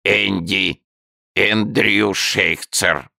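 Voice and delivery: drawn-out newt introducing itself; creaky salamander. A narrator's reading. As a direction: salamander gives its name slowly.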